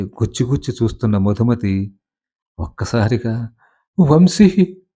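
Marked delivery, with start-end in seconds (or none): 4.0-4.8 sudden high startled cry; shocked cry of a name. A narrator's reading. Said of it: "బిక్కరగా పిలిచి".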